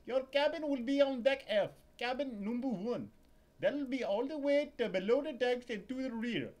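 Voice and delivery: high, loud fussy purser; a high-strung purser griping; ship purser voice. Acted. Purser checking Larry's ticket, high and loud.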